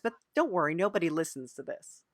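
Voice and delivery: ordinary talk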